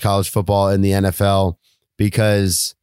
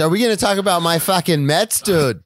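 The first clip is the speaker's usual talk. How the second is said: higher-pitched put-on Boston accent; 6 semitones higher than usual. Co-host hyping his Mets to his partner, in a misfired Boston accent. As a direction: botch a New York accent into Boston. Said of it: "You keep going to the Boston accent".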